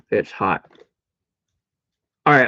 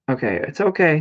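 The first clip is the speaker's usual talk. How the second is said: ordinary talk, nothing marked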